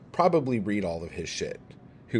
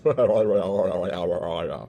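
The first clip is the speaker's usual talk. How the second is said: mocking laugh; 4 semitones lower than usual. Real, not acted. Laughs at mocked critic.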